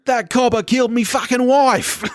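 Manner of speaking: high-pitched ocker mock scream; Vin Diesel screaming. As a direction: wail it like a hammed-up Aussie action hero. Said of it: "can just see Vin Diesel screaming that".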